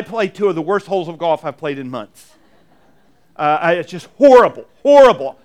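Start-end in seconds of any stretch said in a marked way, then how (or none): 3.4-5.4 louder and rising, fed up with himself; exasperated outburst. Real, not acted. Pastor telling the congregation about his awful golf, in an exasperated loud outburst. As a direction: blurt out the disgust at those holes.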